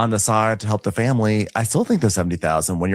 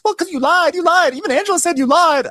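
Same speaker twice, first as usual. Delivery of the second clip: high falsetto, insistent; shrill mock retort, a little louder; 4 dB louder than usual. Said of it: "And she goes".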